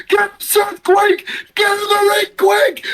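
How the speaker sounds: very high, strained mock yell; retold yelling. Fans yelling at Seth on screen, high mock yell. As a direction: urge the wrestler up in a high squeal. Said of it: "Dylan and I are yelling".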